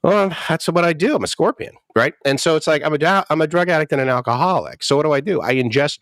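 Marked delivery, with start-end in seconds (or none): 0.0-1.7 high, casual shrug of a scorpion; matter-of-fact scorpion. Retold or imitated. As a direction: explain it like it's obvious. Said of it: "He's like".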